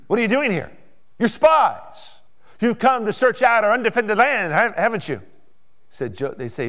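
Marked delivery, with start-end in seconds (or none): 0.1-5.2 loud, high harsh interrogation; harsh accusation. Acted. Joseph confronting his brothers as spies, harsh accusation. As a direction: accuse them sharply, acting it out. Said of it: "he spoke harshly to them".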